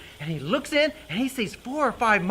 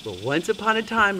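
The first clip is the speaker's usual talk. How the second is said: usual speaking voice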